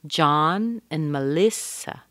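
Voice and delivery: "John" strong, "and" weak, slow; stress on John. Shown as a demonstration. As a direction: hit John and li, slowly. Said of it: "John is strong".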